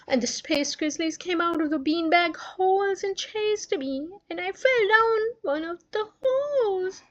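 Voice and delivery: high, teary, whimpering recount; childish high voice close to tears; crying girl. Acted. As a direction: tell the nightmare through sobs. Sister retells nightmare, crying.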